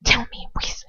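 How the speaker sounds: a tight whisper of held-back irritation; tight, suppressed exasperation. Acted. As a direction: hiss it, barely keeping your temper. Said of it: "Lucius' voice is tight with suppressed exasperation".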